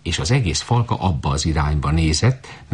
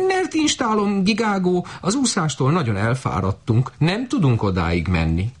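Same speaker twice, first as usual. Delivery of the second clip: high goose voice, weary plea; tired goose; 12 semitones higher than usual. Acted. Goose begging the shepherd girl to rest, high and weary. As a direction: plead like a worn-out goose.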